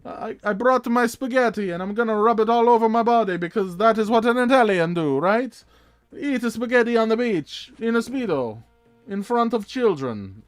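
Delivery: loud, high hammed-up Italian man; mock Italian voice. Imagining Italian Man Horse Summer, a loud hammed-up Italian voice. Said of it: "So it's like".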